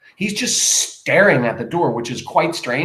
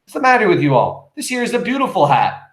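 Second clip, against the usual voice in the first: indignant; 6 semitones higher than usual. Acted.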